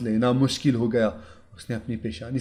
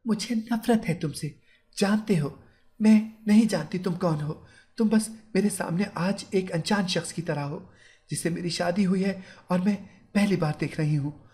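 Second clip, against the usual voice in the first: a hint of cold fury, voice pitched up; angry bride; 6 semitones higher than usual. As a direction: bride tells her groom she hates him, restrained anger. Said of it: "वो गुस्से में बोला".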